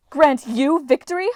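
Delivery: high, loud scornful cry; indignant goddess crying out; cried out. A narrator's reading. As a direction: fling the question back in outrage. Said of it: "the goddess cried".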